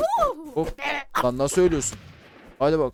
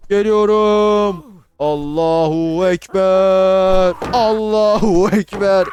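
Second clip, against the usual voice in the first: long, stretched battle shout, loud and high; drawn-out war cry; 3 semitones higher, 8 dB louder, much slower than usual. Acted. Gamer yelling a war cry as his troops charge. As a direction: bellow it like a charging warrior.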